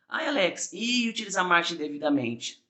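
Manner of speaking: high, asking pupil voice; a lightly voiced student raising a doubt; mock student question. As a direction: play the curious candidate asking. Imagined student asks a question.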